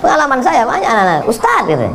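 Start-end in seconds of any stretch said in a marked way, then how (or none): 1.3-1.6 put-on child calling. Children call the preacher.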